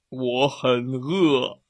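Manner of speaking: slow, drawn-out growling bear voice; bear roar. A narrator's reading. The bear growls at the two friends, slow hungry growl. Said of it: "熊吼道".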